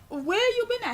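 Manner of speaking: high, incredulous demand; retold exasperation. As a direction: fire the question at him, fed up. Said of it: "I was so pissed".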